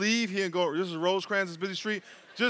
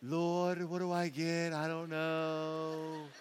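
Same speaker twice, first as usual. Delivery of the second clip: soft, slow, dithering prayer; mock a customer stuck at the menu; indecisive customer praying; 7 dB quieter, much slower than usual. Pastor imitating a slow customer praying over the order, softly. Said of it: "like, trying to".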